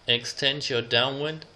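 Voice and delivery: usual speaking voice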